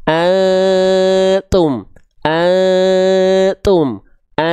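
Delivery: very long hum in "antum", tongue hovering for t; slow chanted "antum" with suspended nasal; concealed n before t. Shown as a demonstration. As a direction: sustain the masked n of "antum", then release "tum". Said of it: "lidahnya dia ngawang kan?".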